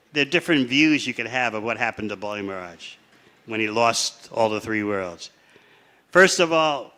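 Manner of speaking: usual speaking voice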